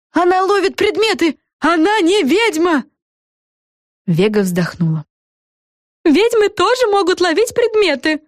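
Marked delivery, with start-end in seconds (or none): 0.1-2.8 high-pitched jeering cry; crowd accusation. A narrator's reading. 6.0-8.2 very high-pitched shouted retort; Френ crying out. A narrator's reading. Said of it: "прокричала Френ".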